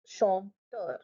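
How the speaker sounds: "Chanteur" as shon - teur; split into syllables. Shown as a demonstration. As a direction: break "Chanteur" after the nasal first syllable. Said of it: "split your word into syllables".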